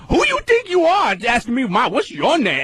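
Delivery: very high-pitched mock outrage; imitating affronted rep. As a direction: mimic a second clerk taking offense, voice way up. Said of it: "And she goes".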